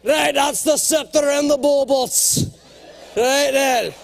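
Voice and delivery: slow, loud, proud Scottish voice; puffed-up Scottish accent. Retold or imitated. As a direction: swagger like a Scotsman in full regalia. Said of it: "He goes".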